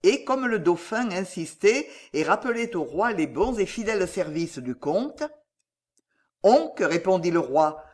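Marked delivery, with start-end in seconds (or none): 6.4-6.8 louder, higher, firm refusal; firm royal refusal, voice raised; inflexible king. A narrator's reading. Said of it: "Charles sept fut inflexible".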